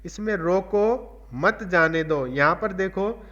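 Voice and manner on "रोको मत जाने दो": slow "रोको", a clear break, then "मत जाने दो" as one phrase; pause after रोको. Shown as a demonstration. Teacher showing a comma after रोको, pause after "रोको". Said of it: "रोको के बाद एक कॉमा लगा है".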